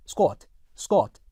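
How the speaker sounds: SQUAT with a rounded short "o": skwot; British rounded vowel. Shown as a demonstration. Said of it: "영국 표준 영어에서는 약간 다르게".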